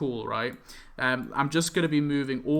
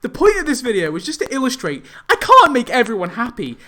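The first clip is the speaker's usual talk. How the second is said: much louder and higher, near yelling; exasperated outburst; 8 semitones higher, 7 dB louder than usual. Real, not acted. Vlogger telling commenters he can't please everyone, exasperated loud. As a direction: make the point, fed up.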